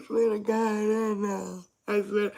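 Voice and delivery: high, loud sobbing plea; crying. Retold or imitated. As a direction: wail it out through tears. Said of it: "He was crying".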